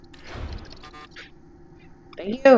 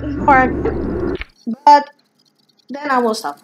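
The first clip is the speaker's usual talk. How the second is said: usual speaking voice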